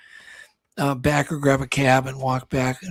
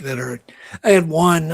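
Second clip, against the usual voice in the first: ordinary talk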